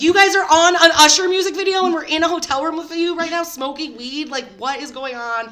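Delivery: loud, high, amazed outburst; excited disbelief. Retold or imitated. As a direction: blurt it out in wide-eyed disbelief. Retold excited disbelief at party.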